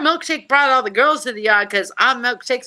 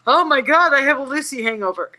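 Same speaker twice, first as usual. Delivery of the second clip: mock dazed exclaim; 5 semitones higher, 4 dB louder than usual. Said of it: "going away by".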